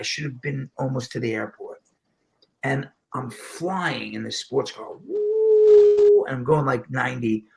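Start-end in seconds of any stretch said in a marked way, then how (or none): 5.0-6.2 high, loud, sustained whoop; excited whoop. Retold or imitated. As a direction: let out a long high "woo". Mimics flying in a sports car.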